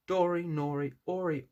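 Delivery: ordinary delivery, nothing marked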